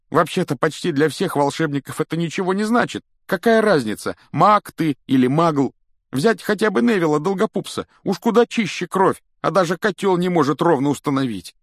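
high, loud, agitated character voice; high-pitched exasperated rant; indignant young wizard. Acted.

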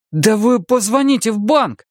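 high-pitched desperate plea. A narrator's reading. Arnie urging the seller to phone the bank, desperate. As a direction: beg urgently, voice pitched right up. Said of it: "В голосе Арни уже слышалось отчаяние".

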